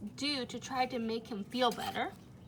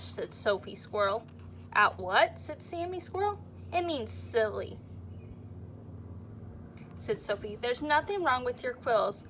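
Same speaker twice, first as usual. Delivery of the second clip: lively storybook reading to a class; reading to children. A narrator's reading. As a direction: read it like story time for little kids. Reads picture book to class.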